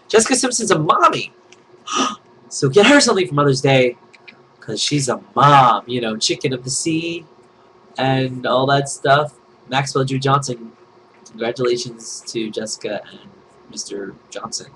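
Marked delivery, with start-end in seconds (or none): none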